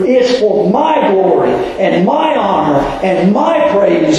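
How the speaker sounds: preacher pressing the congregation, rising and stretching the words; rising sermon. Acted. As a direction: build the point like a pulpit crescendo. Preaching builds in pitch.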